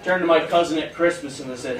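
normal talking voice

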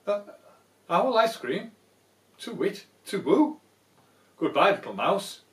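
hammed-up panic; panicked owl. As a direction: hoot and flee.

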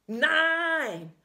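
loud, high cry of dismay; anguished shout. Acted. Mother at the window shouting in despair. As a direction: cry out NO as the plan fails. Mother sees child turn back.

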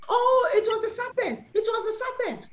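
high, protesting mock excuse; hammed-up high-pitched self-defence; excuse-making woman. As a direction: pass the blame in a high voice. Acting Eve shifting the blame.

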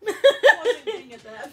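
laughing hard. Real, not acted. Laughing about a misunderstanding.

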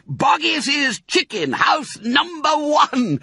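high, slow loud announcement; excited cry. A narrator's reading. Mister Fox to cubs, high triumphant cry. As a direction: proclaim it in triumph. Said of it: "cried Mister Fox".

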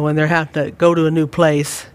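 normal talking voice

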